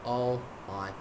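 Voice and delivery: hushed, slowing disbelief; stunned awe. Real, not acted. Gamer stunned by a present, hushed awe. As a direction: let the voice sink, overwhelmed.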